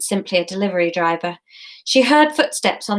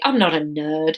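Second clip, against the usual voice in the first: plain reading voice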